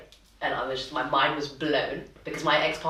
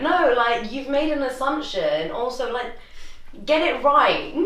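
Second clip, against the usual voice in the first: indignant inner retort; 7 semitones higher, 4 dB louder than usual. Retold or imitated. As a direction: act out the unspoken telling-off. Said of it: "in my head, I was thinking".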